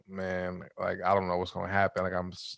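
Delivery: hushed, low-key retelling of nerves; retold scared worry. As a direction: softly voice a worried thought, understated. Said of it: "I'm, like, scared".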